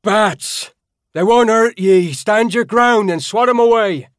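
high, loud bellowed commands; bellowing. Acted. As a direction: bellow over the chaos to the group. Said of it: "bellowed it".

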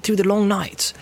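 high, eager hobbit servant, gladdening; a loyal hobbit overjoyed to see his master; Sam, rising to a glad cry; Sam's voice. Acted. Sam speaks to Frodo.

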